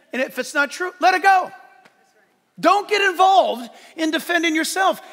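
loud, high "let it go" each time; preaching refrain. Real, not acted. Preacher urging his church to let insults go, loud. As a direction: hammer home "let it go".

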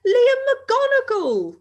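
loud, high, drawn-out greeting; thrilled shout to an old friend; amazed and delighted cry. A narrator's reading. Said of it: "amazed and delighted to see his old friend".

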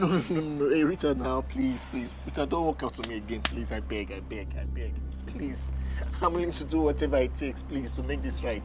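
low, soft, broken begging; desperate pleading. Acted. Husband begs his wife not to walk out, in soft broken pleading. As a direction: plead under your breath as if she's already leaving.

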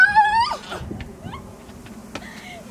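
high, loud screaming laugh; a burst of shrieking laughter; real shrieking laugh at the spill. Real, not acted. Partner spills hot sauce.

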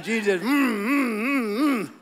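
slow hummed mock tambourine rhythm; imitating tambourine lady. Preacher mocks the tambourine lady to his congregation, hummed. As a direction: hum along like a lady banging a tambourine. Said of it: "she's just going at that thing".

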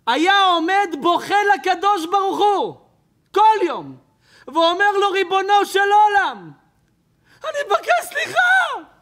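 high, loud impassioned preaching; preaching at full force. Acted. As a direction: cry it out to the crowd, pleading. Preacher building to climax. (דרשה בלהט ובקול רם)

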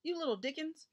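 high-pitched mock yelp and scolding; sudden shout. A narrator's reading. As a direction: exclaim as if scratched, mildly. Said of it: "he shouted suddenly".

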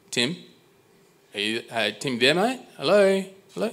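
retold calling out, searching for someone on the phone. Customer to a phone salesman who has vanished from the line, calling out.

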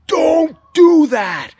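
slow, high, loud warning shout; yell. Retold or imitated. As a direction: shout him away from the spinning blade. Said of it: "That's why I yelled".

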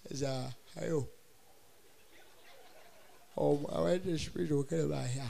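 soft, unhurried presidential voice; a calm Buhari on the phone; calm Buhari impression. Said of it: "When I go hear Buhari voice, very calm guy".

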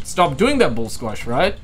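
ordinary delivery

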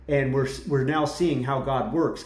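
normal talking voice

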